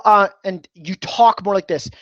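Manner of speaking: usual speaking voice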